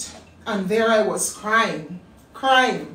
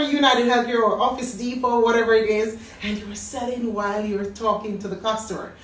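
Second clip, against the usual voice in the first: ordinary talk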